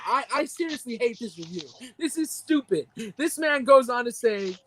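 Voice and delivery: ordinary delivery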